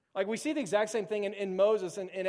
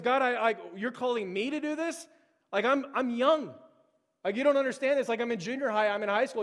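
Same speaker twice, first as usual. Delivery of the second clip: louder, higher, flustered objection; incredulous protest; 4 semitones higher, 4 dB louder than usual. Acted. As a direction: protest in disbelief like an overwhelmed youth. Acts out young Jeremiah protesting.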